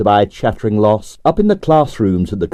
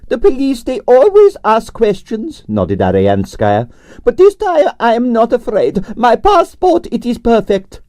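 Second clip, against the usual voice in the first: grande dame Russian ballerina; 12 semitones higher than usual. A narrator's reading. Narrator voicing Arienskaya, imperious and high. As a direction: sigh it out like a tragic prima donna.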